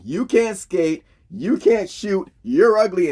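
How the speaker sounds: loud, high, drawn-out insults; blunt coach tirade. Retold or imitated. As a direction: hammer each insult like a coach. Shoresy's blunt locker-room speech to kids.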